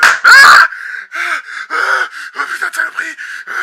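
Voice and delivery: sudden high, loud yelps; startled scream. Acted. Host startled by a bug hitting the window, screaming. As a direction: jump out of your skin.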